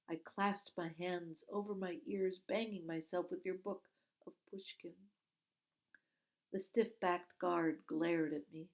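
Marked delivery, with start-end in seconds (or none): none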